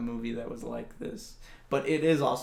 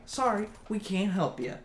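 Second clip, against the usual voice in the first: higher, louder sarcastic brush-off; mock snotty police; 5 semitones higher, 5 dB louder than usual. Police mock the babysitter, sarcastic dismissal. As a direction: sneer like useless cops. Mocking police in retold story.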